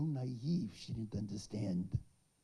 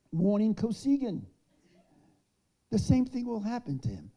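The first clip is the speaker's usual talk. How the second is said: much higher and louder, proclaiming; defiant warning; 8 semitones higher, 9 dB louder than usual. Retold or imitated. Avital publicly warns Kosygin at the rally, defiant. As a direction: deliver it as a fearless threat. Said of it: "She says".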